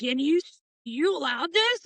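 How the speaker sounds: mild mock yell of disbelief; retold yell. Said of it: "Jack yells at me like".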